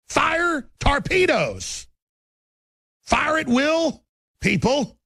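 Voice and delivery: high, slow, drawn-out war cry; mock battle command. Host rallying his audience like a warship captain, drawn-out commanding call. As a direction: bellow it like a captain ordering the attack.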